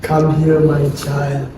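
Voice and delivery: soft, low comforting murmur; calm her gently, quietly; soothing. Acted. Grandfather soothing his frightened granddaughter, soft and tender.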